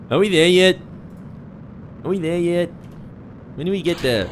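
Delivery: put-on high whiny kid voice; pestering child. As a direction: nag like a bored child on a trip. Mock impatient kid in back seat.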